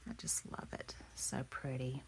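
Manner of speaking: breathy admiring whisper. Real, not acted. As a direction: whisper it in awe. Admiring the handmade flower.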